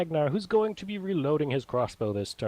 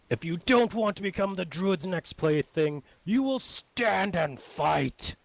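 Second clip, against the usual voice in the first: higher, forceful hobgoblin order, slowing on the threat; hobgoblin commander; 5 semitones higher, slower than usual. Acted. Hobgoblin ordering its frightened goblins to keep fighting, commanding. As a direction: draw out the final order like a threatening warlord. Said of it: "the hobgoblin says".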